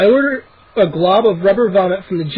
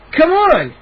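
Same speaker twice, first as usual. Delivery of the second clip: urgent call; 10 semitones higher, 3 dB louder than usual. A narrator's reading. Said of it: "Dad cried".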